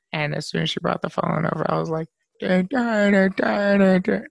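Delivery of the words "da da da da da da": goofy silly babbling; mock crazy gibberish for the camera; playful nonsense noises; acting crazy. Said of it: "just started acting all crazy".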